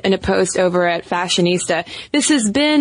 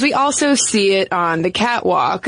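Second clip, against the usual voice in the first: ordinary talk, nothing marked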